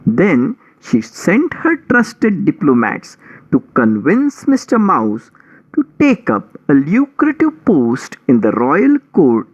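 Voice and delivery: ordinary delivery, nothing marked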